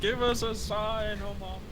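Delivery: slow ghost summons. Acted. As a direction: drag out the plea to the ghost. Slow, high summoning call.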